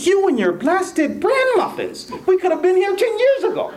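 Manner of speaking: high, exasperated blaming outburst; old man turning on his wife in paradise, high and angry. Acted. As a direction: snap at the wife, fed up with her muffins. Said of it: "Then he gets so angry".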